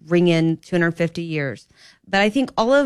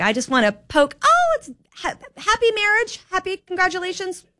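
mock sweet voice; 10 semitones higher than usual. Mocking sweet greeting voice.

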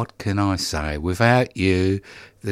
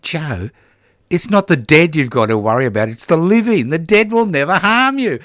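higher and louder, lightly voiced father; dad's advice; 8 semitones higher, 5 dB louder than usual. Son retells his dad's words at the cemetery, raised and louder. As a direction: hand down the lesson as his dad, rising and louder.

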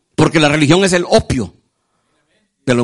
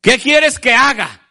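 higher, slow earnest question; Saul asking Jesus; 6 semitones higher, slower than usual. Acted. Saul asking the Lord what to do, slow and higher. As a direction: ask it slowly, ready to obey.

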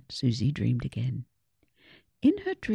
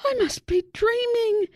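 very high, loud disbelieving cry; can't believe she won; high squeal of amazed delight; astonished exclamation; 5 dB louder than usual. A narrator's reading. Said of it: "exclaimed Susie".

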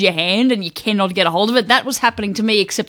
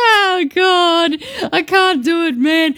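higher, giggly exasperation; can't stop laughing; 9 semitones higher, slower than usual. Real, not acted. Loses composure laughing.

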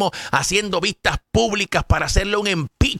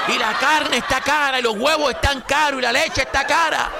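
higher, whiny hammed-up griping; mock partisan complaint; 5 semitones higher than usual. Commentator parodying voters blaming Biden. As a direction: imitate the opponents complaining. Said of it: "Eso es lo que estuvieran diciendo ustedes".

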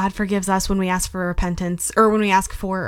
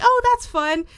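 much higher, cheerful and oblivious; high chirpy reply; 13 semitones higher than usual. Retold or imitated. Answering mom's lunch news, high and chirpy. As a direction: toss it off high and sunny, unaware.

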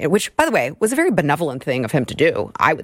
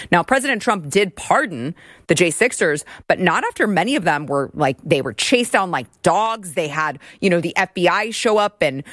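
normal talking voice

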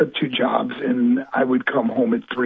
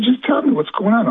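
higher-pitched, anxious demand retold; urgent plea; 8 semitones higher than usual. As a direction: press anxiously for the news. Pleading for news on phone.

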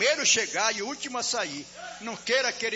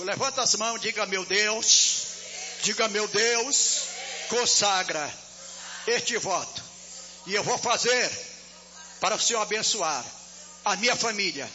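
loud, leading the consecration; preaching prayer; 4 dB louder than usual. Real, not acted. Pastor leads the church consecrating the vow, loud preaching. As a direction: call the crowd to pray along.

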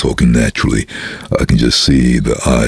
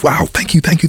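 excited thanks. Retold or imitated. Excited executive quoted.

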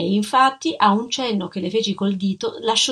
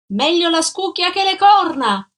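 high, loud jeer down the stairwell; woman shouting an insult down the stairs; shouted taunt; 9 semitones higher, 6 dB louder than usual. Acted. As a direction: yell it after him from the railing. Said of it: "gridò lei affacciandosi alla ringhiera".

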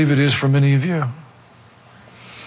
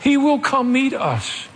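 normal talking voice

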